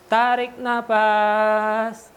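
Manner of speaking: very slow inhale cue; slow breathing cue. Shown as a demonstration. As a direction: draw the words out long. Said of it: "breathing exercise instruction".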